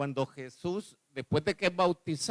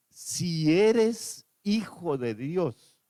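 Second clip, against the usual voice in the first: devil's taunt; 5 semitones higher, 5 dB louder, much slower than usual. Retold or imitated. Devil's taunt quoted in sermon.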